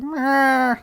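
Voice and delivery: high-pitched, drawn-out Muppet cry, then a loud hey; Fozzie Bear. Acted. Dreamer mimicking the Muppet outside his house, unsure high cartoon impression. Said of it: "I don't know what the fuck his voice was".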